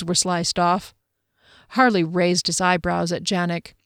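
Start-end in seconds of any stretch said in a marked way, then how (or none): none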